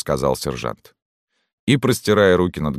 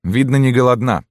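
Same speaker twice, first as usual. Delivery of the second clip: higher, louder soldier's voice; soldier's remark; 8 semitones higher, 5 dB louder than usual. A narrator's reading. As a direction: pipe up from the ranks, pitched high. (реплика солдата, высоким громким голосом)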